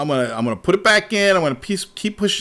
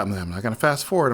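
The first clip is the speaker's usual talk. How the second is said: ordinary delivery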